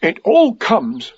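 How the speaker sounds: high, slow, mildly cross complaint. A narrator's reading. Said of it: "said Pooh crossly".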